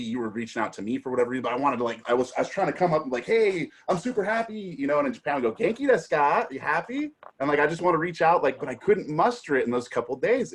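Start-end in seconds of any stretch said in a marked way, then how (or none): 3.2-4.6 high-pitched mock-upbeat greeting; acted cheerfulness. Friend acts out the cheery message he tried to send, mock-upbeat. 5.5-7.1 high, mock-upbeat greeting; acted cheerfulness. Friend quotes his greeting from Japan, mock-upbeat.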